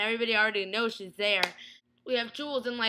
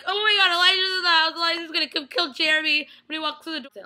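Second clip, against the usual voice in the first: panicked; 6 semitones higher, 6 dB louder, faster than usual. Retold or imitated. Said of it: "I was sitting there like".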